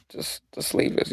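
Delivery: whispered plea. Real, not acted. Pleading with aliens.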